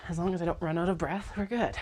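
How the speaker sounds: ordinary talk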